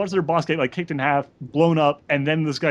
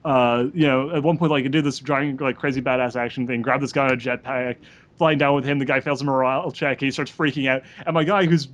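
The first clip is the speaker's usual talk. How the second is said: ordinary delivery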